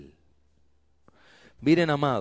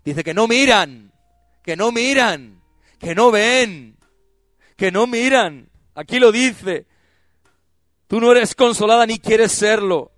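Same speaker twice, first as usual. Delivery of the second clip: louder, higher pulpit preaching; fiery preaching; 8 semitones higher, 12 dB louder than usual. Acted. Preacher scolding Rachel for refusing comfort, fiery preaching. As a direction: drive it home to the congregation.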